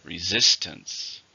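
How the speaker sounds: Resistance said slowly with the second syllable -sis- stressed. Shown as a demonstration. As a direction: stretch out Resistance and lean on -sis-. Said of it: "Stress on the second syllable".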